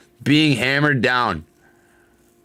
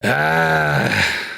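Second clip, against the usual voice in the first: frustrated cry. Real, not acted. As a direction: let out a cry of real frustration. Frustrated over a bad trade.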